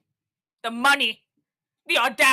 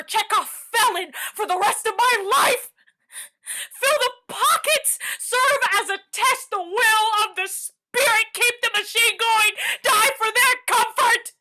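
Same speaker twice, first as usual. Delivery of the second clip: pitched higher, voice close to breaking; rising tearful litany; 6 semitones higher than usual. Acted. Character listing what society demands of her, tearful. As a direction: list each injustice as the tears build.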